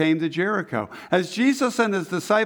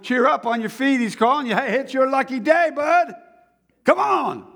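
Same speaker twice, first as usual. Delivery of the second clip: cheery call; 8 semitones higher than usual. Acted. Crowd calls blind man over.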